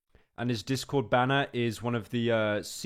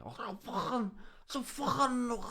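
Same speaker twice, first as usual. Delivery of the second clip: put-on high-pitched caricature of a Scouse accent; mock Scouse accent; 12 semitones higher, 4 dB quieter, much slower than usual. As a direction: squawk out an exaggerated Scouse impression. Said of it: "Average Scouse accent".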